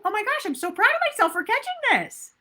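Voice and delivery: high, fast, delighted self-praise; excited self-praise. Shown as a demonstration. Coach models proud self-talk after catching the habit, high and gushing. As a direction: gush with pride at yourself. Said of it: "we can go".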